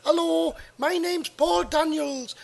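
high, slow put-on Middlesbrough impression; Paul Daniels. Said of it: "he's from Middlesbrough, isn't he?".

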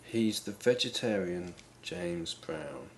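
slow, soft repeat of the clue; slow pondering. Real, not acted. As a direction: mull it over quietly, word by word. Contestant ponders the clue again.

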